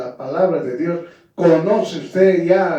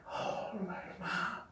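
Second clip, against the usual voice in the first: whisper; 23 dB quieter, slower than usual. Acted. Aside after mocking soft voice.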